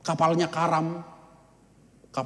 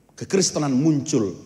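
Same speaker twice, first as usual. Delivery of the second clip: normal talking voice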